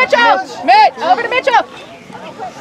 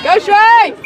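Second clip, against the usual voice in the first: cheering shout; 6 semitones higher, 7 dB louder than usual. Real, not acted. Cheering from the sideline.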